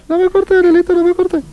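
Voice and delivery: high falsetto pleading; mock pleading caller. Faking the caller begging the host not to hang up, high falsetto. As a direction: beg not to be cut off, in a squeaky falsetto.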